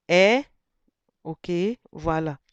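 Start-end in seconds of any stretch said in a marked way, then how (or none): 0.1-0.4 "E" said louder, on its own tone; tone demo on E. Shown as a demonstration. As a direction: give "E" a clear, louder tone. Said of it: "Et ici".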